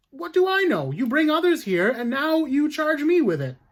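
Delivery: accused woman snapping back at the judge, high and loud; indignant outburst. Acted. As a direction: throw the blame back at the judge.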